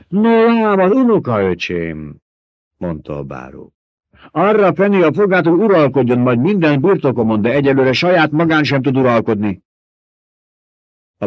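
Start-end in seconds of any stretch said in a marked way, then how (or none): 0.1-9.6 high, loud sly baron voice, taunting his mentat; gloating Baron. A narrator's reading. The Baron needling his mentat about a wrong prediction, high and mocking. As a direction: purr it out like a fat, smug tyrant.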